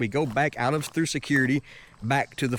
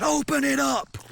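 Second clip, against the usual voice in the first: high-pitched forceful order; really aggressive; 13 semitones higher than usual. Retold or imitated. Guard orders traveler to open suitcase, really aggressive. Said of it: "like, really aggressively, like, saying".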